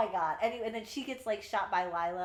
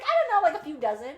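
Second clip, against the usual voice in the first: high, loud, careless chirp; mock ditzy Rue; 9 semitones higher, 11 dB louder, faster than usual. Rue airily telling Gideon how many she told, high and loud. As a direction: toss it off like it's nothing.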